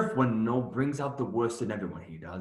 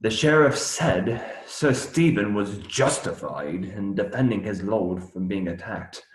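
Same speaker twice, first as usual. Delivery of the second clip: ordinary talk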